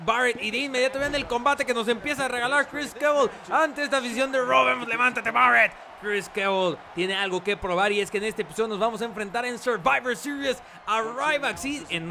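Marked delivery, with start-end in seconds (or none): 0.1-5.7 excited ringside commentator; play-by-play commentary. Acted. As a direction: call the match with energy. Live wrestling play-by-play.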